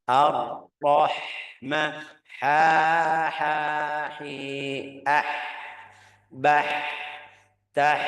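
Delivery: slow "Ar-Rahma", then separate airy "ha, hi, ah, bah, tah"; clean breathy ha. Shown as a demonstration. Teacher drilling until ha is clean, slow breathy syllables. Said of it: "Sampai ucapan ha yang bersih".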